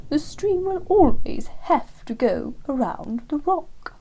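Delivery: slow, high, lofty lecturing owl voice; superior wise owl. Acted. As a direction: explain like a grand old teacher, slowly.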